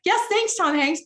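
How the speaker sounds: a hint of a sarcastic fan; sarcastic thanks. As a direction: thank him with a sarcastic lilt. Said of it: "You can be like".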